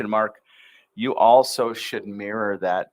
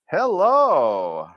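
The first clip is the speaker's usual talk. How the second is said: energetic intro; 2 semitones higher, slower than usual. Shown as a demonstration. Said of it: "Give it the energy".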